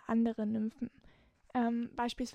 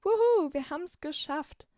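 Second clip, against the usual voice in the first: excited; 4 semitones higher, 7 dB louder than usual. Real, not acted. Cheering at the podcast's end.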